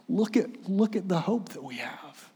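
quiet awed invitation; soft invitation. Acted. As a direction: hush and point toward the hope. Elder inviting John to hope.

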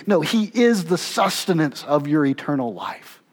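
ordinary talk

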